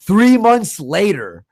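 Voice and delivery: ordinary talk, nothing marked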